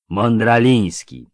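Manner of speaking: slow, dopey drawl; drawn out. Acted. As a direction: stretch the word like a grinning oaf. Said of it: "przeciągle".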